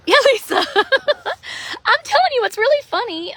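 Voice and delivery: high giggly laughter through words; laughing. Real, not acted. To Lisa on the live stream, laughing hard.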